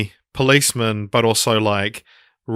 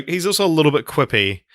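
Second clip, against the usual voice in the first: normal talking voice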